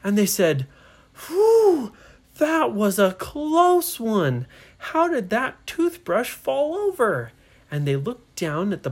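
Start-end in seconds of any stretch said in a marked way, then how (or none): 1.3-7.3 loud high whew, then wondering; amazed relief. Acted. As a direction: exclaim over a narrow escape. Relief after a narrow escape.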